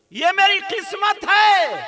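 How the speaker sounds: high, loud, slow chanting cry; soaring sustained preaching call; exultant preacher's cry. Retold or imitated. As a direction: belt it out, holding the last word. Same words, now chanted out.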